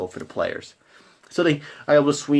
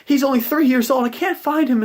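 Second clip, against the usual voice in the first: high, louder, distraught mother; a mother who lost her child, pitched way up; 12 semitones higher, 3 dB louder than usual. Acted. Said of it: "She's like".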